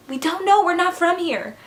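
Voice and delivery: high, loud mock-panicked outburst; panicky protest. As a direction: burst out in exaggerated protest. Acted protest, outburst after hush.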